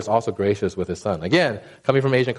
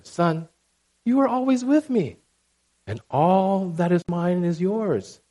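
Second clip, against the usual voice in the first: gentle father; 6 semitones higher, slower than usual. Retold or imitated. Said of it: "And the father said to him".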